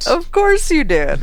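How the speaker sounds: high, slow, knowing drawl; sarcastic drawl. Real, not acted. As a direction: stretch it out, teasing. Teasing reply to Evan.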